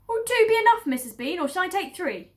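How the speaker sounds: high, loud servant voice; meek Mabel. Acted. Farm woman answers her mistress, high and loud. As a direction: answer quickly, eager to please.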